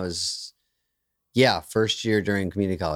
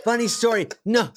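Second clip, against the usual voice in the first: usual speaking voice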